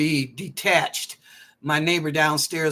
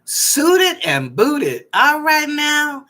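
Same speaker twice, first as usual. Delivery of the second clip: slow, drawn-out, louder and higher praise; sassy hype; 8 semitones higher, 7 dB louder, much slower than usual. Real, not acted. Hyping up a caller's look.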